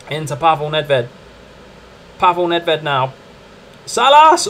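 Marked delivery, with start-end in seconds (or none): 3.9-4.5 sudden high rising shout; excited shout. Real, not acted. Gamer yelling at the striker's shot, excited. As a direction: yell the name as it goes.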